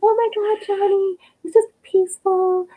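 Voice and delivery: high, gushing mock teacher voice. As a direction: gush like an overeager teacher.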